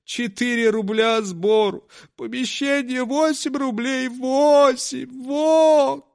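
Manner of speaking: high, tearful complaint; a weepy conjuror counting losses; conjuror's lament. Acted. Conjuror complaining about his takings, tearful and high.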